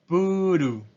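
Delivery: puru as pu-ru, tapped r; Japanese loanword. Shown as a demonstration. Teacher showing students the Japanese reading: プール. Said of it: "Entonces tenemos".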